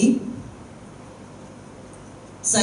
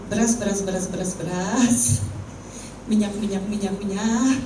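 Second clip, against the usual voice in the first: fussy itemizing; 2 semitones lower, 4 dB quieter than usual. Shown as a demonstration. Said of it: "kerjanya gini".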